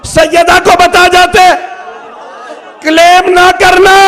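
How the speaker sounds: high, shouted sermon climax; full-force preaching. Acted. Preacher argues what the Prophet would have told Sayyida, shouting at full force. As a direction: build from loud declamation into a sustained high shout.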